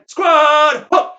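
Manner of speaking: high, slow, drawn-out order; shouted drill command. Acted. Veteran halting the squad, shouted order. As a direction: bellow the halt to the squad.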